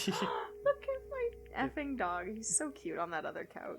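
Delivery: cooing over dog. Real, not acted. Gasps at her dog.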